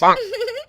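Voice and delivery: high mocking cackle; mocking laugh. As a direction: laugh at him. Wife laughs at husband. (मज़ाक उड़ाती हँसी)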